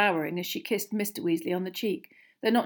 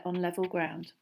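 ordinary delivery

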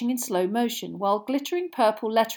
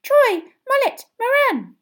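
very high, slow squeaky chant of names; squeaking; 8 dB louder, slower than usual. A narrator's reading. The rosette on Harry's chest squeaks the players' names. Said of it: "the rosette kept squeaking their names".

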